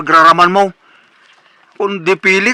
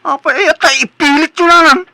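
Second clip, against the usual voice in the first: indignant protest; 9 semitones higher, 3 dB louder than usual. Acted. Son protests to his mother.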